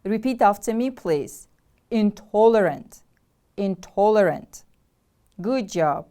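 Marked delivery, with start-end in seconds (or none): 1.9-3.0 "Intolerant" with a strong, stressed TOL; stress on second syllable. Shown as a demonstration. Teacher models the word for repeating, stress on TOL in "Intolerant". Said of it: "The stress is on the second syllable". 3.6-4.6 "Intolerant" with a strong, stressed TOL; stress on second syllable. Shown as a demonstration. Teacher models the word for repeating, stress on TOL in "Intolerant". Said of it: "The stress is on the second syllable".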